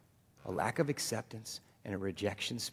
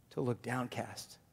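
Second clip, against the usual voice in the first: normal talking voice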